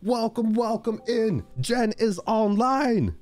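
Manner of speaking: very high, thrilled welcome; excited, cracking voice. Real, not acted. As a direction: push the greeting up high until the voice cracks. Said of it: "my voice is, like, cracking today".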